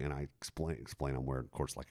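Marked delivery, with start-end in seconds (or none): none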